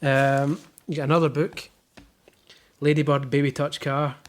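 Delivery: ordinary talk, nothing marked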